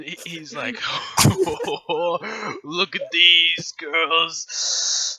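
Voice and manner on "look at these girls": a hint of a sleazy fat patron; lightly voiced leering old soldier; lecherous fat guy. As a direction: GM voicing a lecherous fat patron to the player's character. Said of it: "It's the voice".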